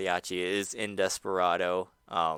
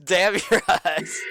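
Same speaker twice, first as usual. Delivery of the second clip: mock curse; 8 semitones higher, 6 dB louder, slower than usual. Echoing mock curse slowly.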